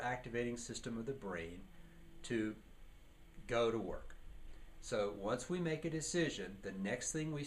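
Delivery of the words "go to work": ordinary talk, nothing marked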